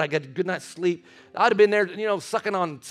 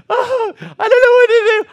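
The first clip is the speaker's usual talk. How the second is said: put-on high, loud girlish wail; wailing teen girl; 12 dB louder than usual. Girl in the van crying over her breakup, wailing high. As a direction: whine it like a heartbroken teenager. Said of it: "She goes".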